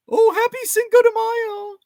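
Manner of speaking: very high, loud wannabe reveller; mock party cheer. Mocking own twenties crowd over margaritas, squealed party cheer. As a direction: mimic a silly celebrating drinker. Mocks wannabe drinkers celebrating.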